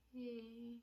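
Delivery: barely voiced, drained hello; a weak breathy hey; faint whisper. Faint greeting before passing out.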